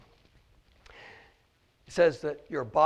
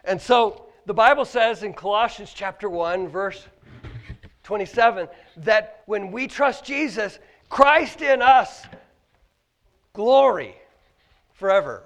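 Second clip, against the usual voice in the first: preaching; 5 semitones higher, 11 dB louder than usual. Acted. Preaching a verse.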